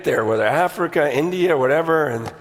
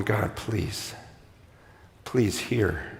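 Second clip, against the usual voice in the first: quiet, softer begging prayer; hushed pleading prayer; 12 semitones lower, 10 dB quieter, much slower than usual. Retold or imitated. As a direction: whisper a desperate plea to God. Prays pleading in the village.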